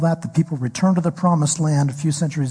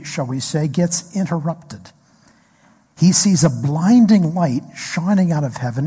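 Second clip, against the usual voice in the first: usual speaking voice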